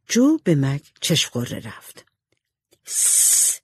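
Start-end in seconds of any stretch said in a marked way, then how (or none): 2.8-3.6 sharp "sss" shushing hiss. A narrator's reading. Jo silencing her sister before she tells, shushing. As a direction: hush someone with a long hiss.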